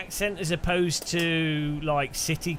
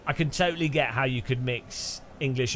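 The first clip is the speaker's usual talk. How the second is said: ordinary delivery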